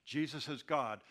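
ordinary talk, nothing marked

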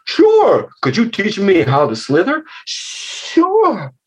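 put-on high, bouncy children's animal voices; curious young frog and snake. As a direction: play two little animals delighted to meet.

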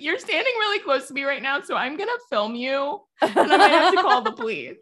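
high-pitched, confrontational complainer; entitled Karen. Acted. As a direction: act out a pushy complainer threatening to call police. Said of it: "speaking in that tone of voice".

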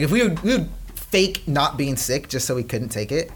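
ordinary talk

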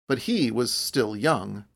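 ordinary delivery, nothing marked

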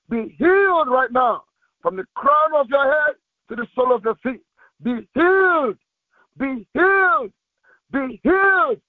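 normal talking voice

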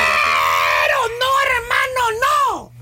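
hammed-up tearful shout of disbelief; dramatic wail. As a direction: howl it like a hammed-up heartbreak. Repeats the mock lament.

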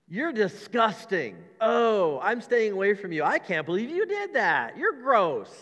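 loud, slow, high mock disgust; mock disgusted Jesus. Preacher mocking a disgusted Jesus, loud and hammed-up. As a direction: recoil in hammed-up horror at a sinner. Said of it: "he's going".

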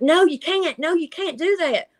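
high frantic no, no; begging desperately, voice up high; a dreamer pleading with a creature; panicked pleading. Retold or imitated. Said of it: "I was like".

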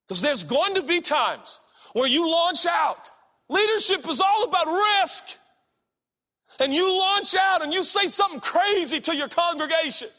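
loud, high, pounding preacher shout; full-force preaching. Acted. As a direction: drive it home to the congregation.

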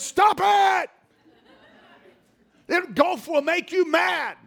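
high, loud shout at a sulking son; retold shout. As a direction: yell at the boy to stop.

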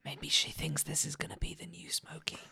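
unvoiced teasing whisper. Real, not acted. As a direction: breathe it as an aside. Whispered joke to table.

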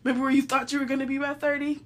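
ordinary delivery

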